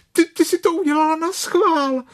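very high, spluttering piglet; sputtering. Acted. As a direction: splutter out indignantly. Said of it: "prskalo prasátko". (prskavě, velmi vysokým hláskem)

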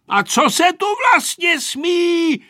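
put-on high, loud boy's protest; impatient shout. A narrator's reading. Vojta fed up with grandpa's bans, impatient shouting. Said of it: "vykřikl netrpělivě Vojta".

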